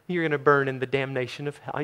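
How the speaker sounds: said gently and softly, mock nice; a mockingly nice delivery of a harsh line; soft sweet tone. Shown as a demonstration. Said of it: "you can't say that nicely".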